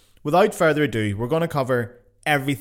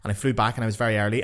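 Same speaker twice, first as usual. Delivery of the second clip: ordinary talk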